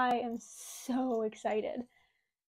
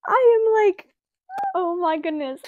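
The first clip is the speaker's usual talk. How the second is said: much higher and louder, a thrilled squeal; excited squeal; 7 semitones higher, 9 dB louder than usual. Real, not acted. Vlogger unboxing her new saddle pad for viewers, thrilled squeal. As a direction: burst out in delight at the first sight.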